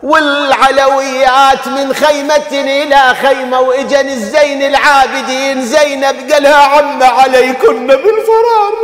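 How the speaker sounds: high, loud mourning cry to the crowd; full-force lament preaching. Acted. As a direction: preach the tragedy at the top of your voice. (خطابة حسينية بأعلى صوت)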